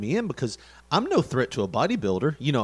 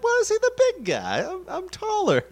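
high, squeaky mock protest; squeaky indignant "I'm taller"; whiny high complaint. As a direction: whine it like a kid.

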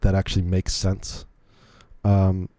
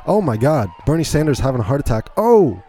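excited disbelief; 6 semitones higher, 3 dB louder than usual. Real, not acted. Reacting to a wild video.

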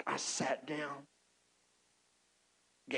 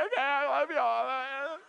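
teary gushing; 10 semitones higher, 5 dB louder, slower than usual. Retold or imitated. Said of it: "I'm crying".

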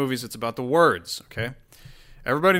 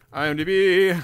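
higher, louder plea to a website; calling out; 6 semitones higher, 6 dB louder than usual. Pretend. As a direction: holler the name like summoning it. Calls on the failing website.

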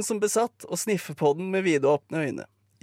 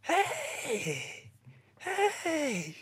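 high, thin, hoarse calling; hes stemme; 10 semitones higher, 8 dB quieter than usual. Acted. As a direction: rasp out a drawn-out greeting, high and soft. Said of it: "Stemmen hennes er hes". (hes, lys og langtrukken hilsen)

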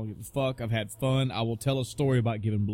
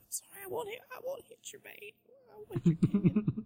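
very high, soft squeaky apology; squeaky timid airman; 6 dB quieter than usual. Retold or imitated. Host mocking the nervous airman, very high and soft. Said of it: "They're like".

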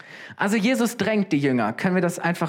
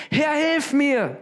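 slow, much higher cry for help; desperate cry; 10 semitones higher, slower than usual. A narrator's reading. Peter sinking in the storm, begging Jesus, high and slow. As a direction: Peter cries out as he sinks, pleading. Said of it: "schrie er".

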